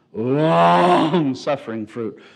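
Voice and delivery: slow and loud, leaning on "Long"; drawn-out emphasis. Acted. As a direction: stretch "Long suffering fruit" out with weight. Joke about patience in marriage.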